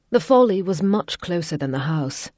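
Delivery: ordinary delivery, nothing marked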